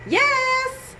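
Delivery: put-on high little kid's yes; child's voice. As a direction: answer like a small boy. Child's answer.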